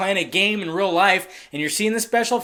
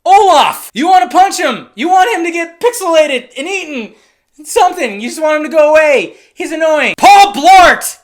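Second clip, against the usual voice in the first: loud, higher rant about the annoying sidekick; exasperated shouting; 7 semitones higher, 10 dB louder than usual. Real, not acted. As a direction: yell it out, fed up and fired up. Ranting about the annoying character.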